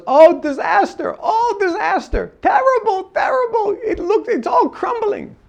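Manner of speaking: high, laughing mock despair; laughing Malaysian billionaire. Imitating the billionaire friend laughing off his business disaster. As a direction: cheerfully wail that it's all a disaster. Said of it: "He goes".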